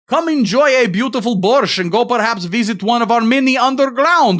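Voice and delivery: a mock Ukrainian tourism ad read in a thick Eastern European accent; heavy Eastern European accent. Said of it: "read it in the heavy accent".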